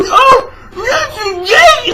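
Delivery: high, whiny mock sobbing; exaggerated squealing cry-baby imitation. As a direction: wail it way up high, mocking her tears. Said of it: "She's crying for".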